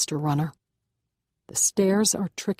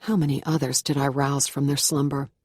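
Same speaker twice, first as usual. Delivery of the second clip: plain reading voice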